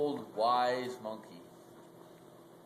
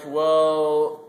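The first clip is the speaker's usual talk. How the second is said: loud well; 3 semitones higher, 13 dB louder than usual. Acted. Timon and Pumbaa answering together.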